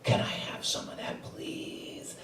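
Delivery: pleading whisper; hushed begging. Retold or imitated. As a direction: beg softly so no one hears. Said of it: "and I say".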